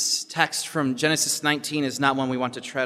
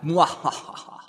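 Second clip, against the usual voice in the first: evil laugh; 4 semitones higher, slower than usual. Retold or imitated. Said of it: "and his evil laugh?".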